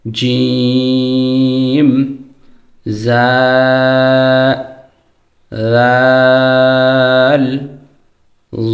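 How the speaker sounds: sung drawn-out জিম, জা, রাল, জ; four letters, chanted one by one; Arabic letter sounds. Shown as a demonstration. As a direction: hold each letter long in recitation style. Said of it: "খেয়াল রাখতে হবে".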